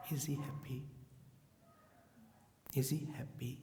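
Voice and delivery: soft, hushed wondering; anxious inner question. Retold or imitated. Disciple silently wonders if Gurudev is happy, hushed. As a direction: ask it quietly to yourself. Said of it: "only one question was there".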